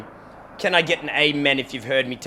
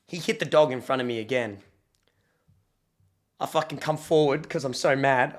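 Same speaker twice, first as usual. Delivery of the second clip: normal talking voice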